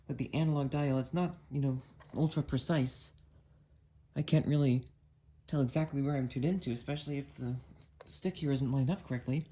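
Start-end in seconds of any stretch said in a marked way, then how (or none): none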